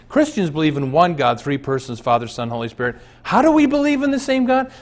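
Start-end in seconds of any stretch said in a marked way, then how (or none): 3.2-4.7 high, fast incredulous question; rhetorical question. Real, not acted. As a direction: toss the question out high and quick.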